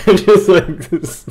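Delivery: laughing. Real, not acted. Laughing while imitating bear.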